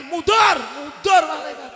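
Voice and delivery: a sharp, loud barked command to back off; angry shout. Retold or imitated. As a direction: shout the angel away. Umar snapping the angels back.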